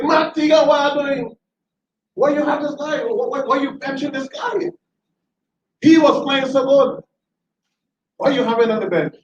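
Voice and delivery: high, shouted, indignant questions at the coach; livid yelling. Retold or imitated. Fan confronting the coach about benching a player, high indignant shout. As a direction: relive storming down from the bleachers to confront the coach. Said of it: "and I'm livid".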